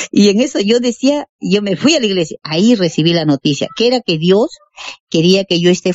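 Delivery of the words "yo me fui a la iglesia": ordinary talk, nothing marked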